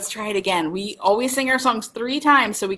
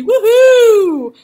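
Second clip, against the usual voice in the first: loud cheer; 12 semitones higher, 10 dB louder than usual. Acted.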